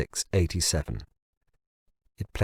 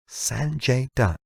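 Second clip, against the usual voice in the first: plain reading voice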